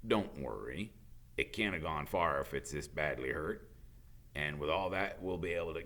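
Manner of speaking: a hint of a gruff, worried gamekeeper; low, worried gamekeeper explaining; lightly voiced Hagrid. Hagrid tells Harry about unicorns, lightly voiced and worried.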